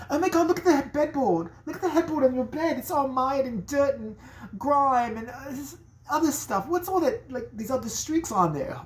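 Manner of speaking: high, horrified British lady voice; high British woman appalled by the headboard. Retold or imitated. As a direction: shriek at the filthy headboard, British. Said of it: "I'm not dropping the British accent".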